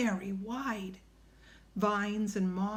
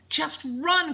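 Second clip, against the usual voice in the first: loud, higher-pitched command; urgent cry; 7 semitones higher, 6 dB louder than usual. A narrator's reading. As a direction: shout it to get him moving. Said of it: "cried Annie".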